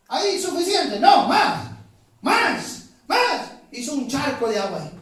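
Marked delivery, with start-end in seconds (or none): 0.1-3.5 high, punched repeated orders; insistent command. Retold or imitated. Prophet ordering more water poured on the altar, insistent and high. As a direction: demand it in loud, separate bursts.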